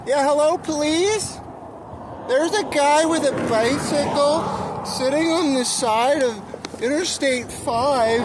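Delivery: high, slow put-on voice of a nosy caller; mock tattletale caller. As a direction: squeak out a fake call to the police. Mock call reporting himself.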